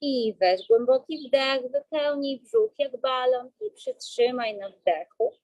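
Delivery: slow, calm breathing-coach voice; guided breathing. Acted.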